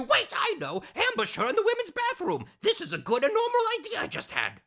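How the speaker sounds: very high eccentric scientist; hammed-up batty doctor voice; Doctor Madnar. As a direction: prattle on high and excitable, swooping about.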